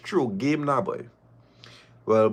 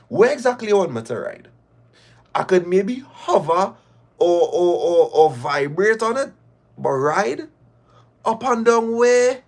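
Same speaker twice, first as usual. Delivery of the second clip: higher, fast mocking questions; mocking incredulity; 7 semitones higher than usual. Caller mocking Chris's request, higher and teasing. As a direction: tease him with disbelief.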